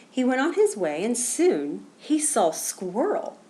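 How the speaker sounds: ordinary delivery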